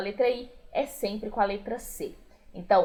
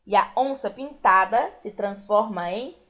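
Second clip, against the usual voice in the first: ordinary talk, nothing marked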